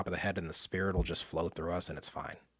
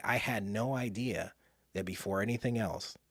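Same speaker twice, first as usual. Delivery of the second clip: ordinary talk, nothing marked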